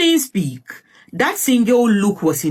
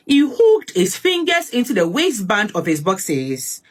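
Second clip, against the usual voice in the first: plain reading voice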